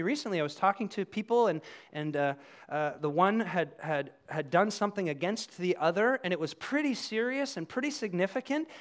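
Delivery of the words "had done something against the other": ordinary talk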